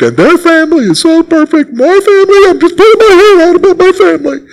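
high, whiny voice of a jealous believer; preacher mocking a congregant envying another family; mock envious churchgoer.

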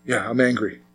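soft, resigned admission; a hushed, deflated confession; quiet admission. Retold or imitated. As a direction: concede it under your breath. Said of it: "you'll be like".

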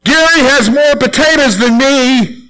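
put-on high, slow whining kid voice; whiny child. Boy complaining to his mom at dinner, high whine. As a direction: whine it like a little boy at the table. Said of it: "then I would say".

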